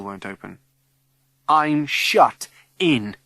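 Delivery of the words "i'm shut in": very slow, louder dread; locked in school, slow panicked dread; dawning panic. Acted. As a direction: let the terror land slowly. Trapped realisation.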